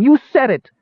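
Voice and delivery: high, loud dwarf outrage; shrieked accusation. A narrator's reading. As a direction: throw the blame in a shriek. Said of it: "she shrieked".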